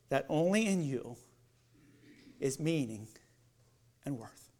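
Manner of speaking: hushed, sincere prayer; soft prayer. Acted. Believer answering the Lord, hushed sincere prayer. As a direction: pray it quietly from the heart. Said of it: "You can say".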